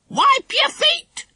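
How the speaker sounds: very high, slow squawking parrot voice; a parrot scolding like a nanny; stern parrot. Acted. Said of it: "she said sternly".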